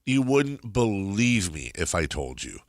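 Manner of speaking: normal talking voice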